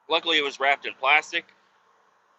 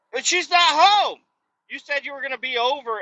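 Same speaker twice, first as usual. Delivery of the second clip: exasperated outburst; 11 semitones higher, 4 dB louder than usual. Real, not acted. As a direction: burst out at the absurdity, stretched. Mocking neighbor's logic.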